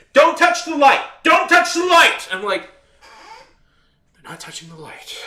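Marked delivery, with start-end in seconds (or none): 0.1-2.3 loud, high-pitched imitation of a yelling man; mocking shout, far higher than usual; obsessive yelling. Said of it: "gets obsessive with yelling at people". 4.1-5.2 soft aside after the yelling; quiet, exasperated retort; muted reply. Retold or imitated. Own muted reply to his yelling.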